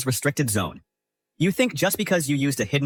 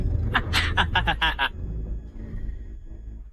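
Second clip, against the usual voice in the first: villain's laugh trailing off; gloating laugh; 4 dB quieter than usual. Acted. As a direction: burst of gloating laughter that dies away. Villain gloats over captive princess.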